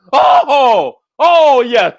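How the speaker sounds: ordinary talk, nothing marked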